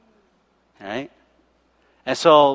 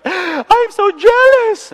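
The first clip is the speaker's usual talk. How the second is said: much higher, slow and louder, swooning envy; hammed-up swoon of envy, much higher and slow; swooning driver; 6 dB louder, much slower than usual. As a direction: gush with longing like the driver. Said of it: "he was like".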